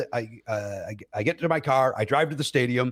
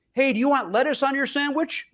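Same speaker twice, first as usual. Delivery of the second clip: high mock yell of each ingredient; 11 semitones higher than usual. Woman yelling ingredients across the shop, mock yell. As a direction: imitate her hollering ingredients. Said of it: "she gets down to".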